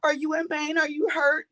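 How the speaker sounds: high, urgent string of worried questions; frantic husband. Retold or imitated. Caller acting her husband going crazy with worry, frantic. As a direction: fire off panicked questions, pitched high. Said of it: "he's going crazy".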